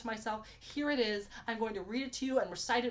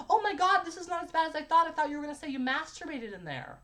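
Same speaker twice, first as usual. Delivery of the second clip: high, loud retold reaction from the girl; imitating her reaction; 6 semitones higher, 4 dB louder than usual. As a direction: pitch it up as her, easing down by the end. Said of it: "She goes".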